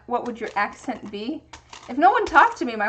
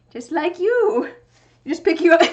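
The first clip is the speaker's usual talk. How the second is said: higher, louder pet talk to a cat; cooing to the cat; 6 semitones higher, 8 dB louder than usual. Real, not acted. Talking to her cat.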